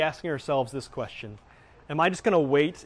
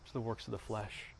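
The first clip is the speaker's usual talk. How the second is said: ordinary delivery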